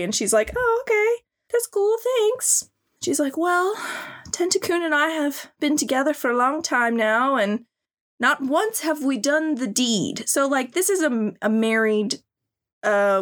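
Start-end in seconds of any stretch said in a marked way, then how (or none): 0.6-2.7 put-on high, breezy girly voice; high mock girl voice, easygoing; mock anime girl. Voicing the girl in the hentai. 3.4-10.3 a lightly put-on heroine voice; high mock girl voice, confiding; mock anime girl. Voicing the girl in the hentai.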